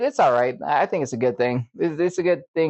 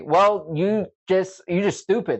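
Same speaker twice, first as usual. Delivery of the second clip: higher, drawn-out mad coworker; mock angry; 5 semitones higher, slower than usual. Coworkers scolding him for relying on his phone, mock angry. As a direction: imitate an annoyed coworker's put-down. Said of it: "then they got mad".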